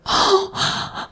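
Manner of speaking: airy, whispered gasp of shock; mock gasp. The churchwomen gasping at the pastor's idea, a mock gasp. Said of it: "all the women were like".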